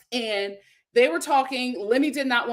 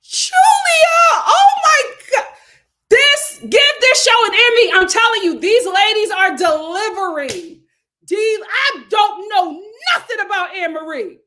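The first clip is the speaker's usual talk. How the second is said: high, loud shout of delight; ecstatic raving; 10 semitones higher, 10 dB louder than usual. Real, not acted. Host raving to viewers about a favorite show, ecstatic. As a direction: belt out the praise at full pitch.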